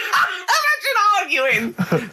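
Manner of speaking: very high, slow laughing squeal; cracking up mid-sentence; helpless laughter. Real, not acted. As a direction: squeal the words out through laughter. Cracking up at co-host's complaint.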